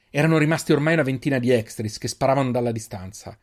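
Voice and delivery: usual speaking voice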